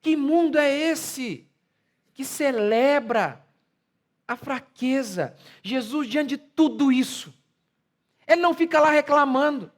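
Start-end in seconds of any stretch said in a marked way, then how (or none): 0.0-5.3 very slow, weighty phrasing; slow indignant question. Real, not acted. Preacher protests a world that celebrates weakness, slowly. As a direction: lay out each word with dismay. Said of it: "slow emphatic question".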